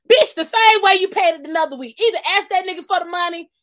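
sharp loud retort; loud scolding. Real, not acted. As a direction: snap back at her, loud. Angry retort, +7 dB.

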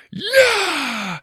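put-on high yell of a boy fighting; battle yell. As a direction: yell it like charging a dragon. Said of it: "he yelled".

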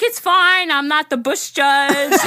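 high, loud comic reassurance; playful retold quip; joking reassurance. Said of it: "she was joking right back at me".

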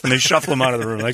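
ordinary delivery

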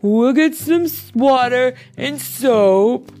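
loud, high, encouraging bear; emphatic can-do voice; rallying. A narrator's reading. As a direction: lead the cleanup eagerly. Bear Bear rallies the animals.

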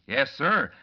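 normal talking voice

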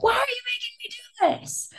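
exasperated whine at the co-writers; whiny high complaint. Retold or imitated. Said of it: "I was so mad".

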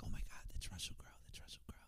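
hushed, hurried celebrity-spotting whisper; imitated crowd whisper. Onlookers spotting a film star on a flight, whispering. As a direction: whisper it like onlookers passing word along. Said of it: "everyone kinda whispering to each other".

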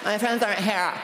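hammed-up daughter complaining her friends are late; silly daughter voice. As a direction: play the daughter as an over-dramatic whiner. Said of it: "That's not how she talks, but it's really funny".

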